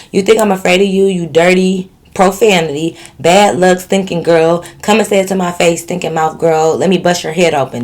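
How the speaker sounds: ordinary delivery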